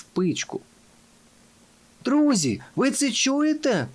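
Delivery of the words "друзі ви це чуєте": high-pitched alarmed call; exclaiming. A narrator's reading. Fox asking his friends about a noise, exclaiming. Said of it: "вигукнув лис".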